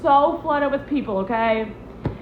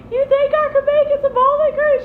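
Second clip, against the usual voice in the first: hopeful high question; 13 semitones higher, 4 dB louder than usual. Retold or imitated.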